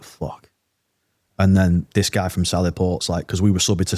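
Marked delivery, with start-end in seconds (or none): 0.0-0.5 much softer, under the breath; hushed dismay. Retold or imitated. Guard watching executions on camera, hushed dismay. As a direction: let the curse drop away quietly.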